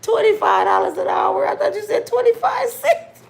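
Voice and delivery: loud, high shout of disbelief; shocked shout. Real, not acted. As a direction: yell it, amazed at the number.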